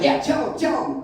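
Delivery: high, loud, insistent prodding; pushy, excited encouragement; eager urging. Retold or imitated. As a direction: egg him on excitedly. Woman eggs him on.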